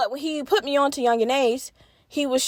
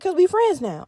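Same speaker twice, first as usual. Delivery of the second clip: friendly dealer; 8 semitones higher than usual. Retold or imitated. Dealer telling the narrator they are friends, high chummy tone. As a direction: play him like an easy buddy. Dealer voiced in retold story.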